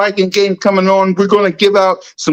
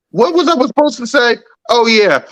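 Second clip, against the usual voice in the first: usual speaking voice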